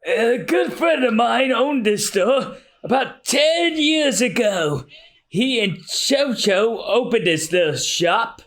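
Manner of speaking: put-on slow, high old man remembering; old mayor reminiscing. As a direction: tell it fondly, slowly. S1 voices mayor.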